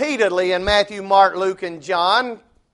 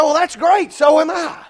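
higher, delighted mock cheer; excited agreement; 8 semitones higher than usual. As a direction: play him thrilled to find a match. Man voiced, excited agreement.

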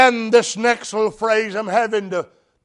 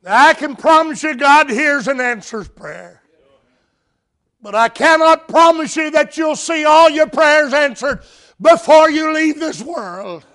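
preaching at full force; 8 semitones higher, 6 dB louder than usual. Acted. Preacher proclaiming to the church, loud and building.

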